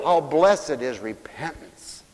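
ordinary talk, nothing marked

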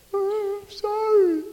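slow, high tot's plea; tiny child voice. Retold or imitated. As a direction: drag it out like a toddler tugging a sleeve. Child tugging on parent's clothes.

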